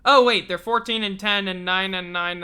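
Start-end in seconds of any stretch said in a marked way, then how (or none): none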